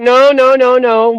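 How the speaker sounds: loud, high insistent no's from mom; mom insisting. Retold or imitated. Mom insists, voiced loudly.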